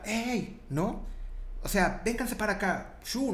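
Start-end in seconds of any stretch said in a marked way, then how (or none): 0.0-0.6 high-pitched call, not really shouted; mock herding call. Shepherd calls a sheep back from a ravine, mock high call. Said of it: "le grita a la ovejita". 2.0-3.3 high herding call at ordinary volume; imitated shepherd's call. Shepherd calls his flock over, improvised high herding call. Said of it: "no sé cómo les hagan".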